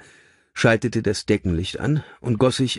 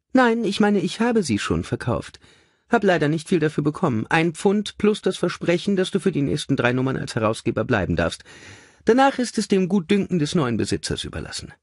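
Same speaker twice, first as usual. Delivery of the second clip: high, chirpy lady's voice; brisk cheerful lady; 11 semitones higher than usual. A narrator's reading. Mother showing her son the figures, brightly high. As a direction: hand it over brightly, lilting high.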